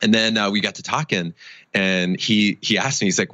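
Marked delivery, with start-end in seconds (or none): none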